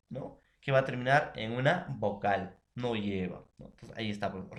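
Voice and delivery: ordinary delivery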